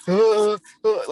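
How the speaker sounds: high, loud excited uh-uh sounds; a mock excited grunt from the son; imitating excited grunts. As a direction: mimic an excited young man trying to speak. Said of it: "he was all excited".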